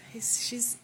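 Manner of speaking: ordinary delivery, nothing marked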